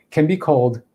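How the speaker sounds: ordinary talk, nothing marked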